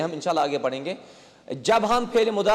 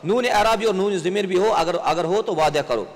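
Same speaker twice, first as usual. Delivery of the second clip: ordinary delivery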